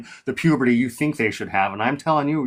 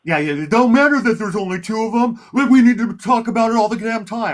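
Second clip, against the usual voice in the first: high, loud, sneering sarcasm; mock the other guy's obsession with a sneer; sarcastic mockery; 11 semitones higher, 4 dB louder than usual. Real, not acted. Taunting her debate opponent Jersey Joey, high sarcastic sneer. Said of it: "that's being emotional again".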